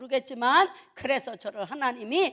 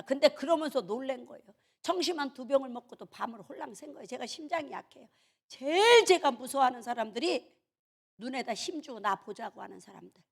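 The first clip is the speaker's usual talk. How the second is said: ordinary delivery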